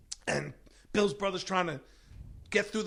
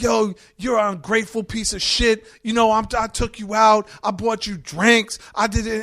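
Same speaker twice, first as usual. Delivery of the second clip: higher, louder shouting tirade; angry yelling; 6 semitones higher, 9 dB louder than usual. Retold or imitated. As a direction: scream at the ungrateful brother. Bill yelling at brother.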